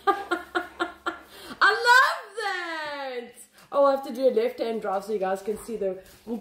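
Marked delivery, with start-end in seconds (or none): 0.1-3.5 laughing. Real, not acted. Laughing delight.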